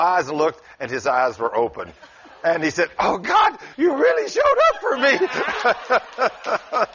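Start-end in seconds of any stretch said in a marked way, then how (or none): 3.0-6.9 very high, cracking cry of amazement; imitated scream. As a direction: shriek it to God in hammed-up astonishment. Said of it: "I heard somebody scream".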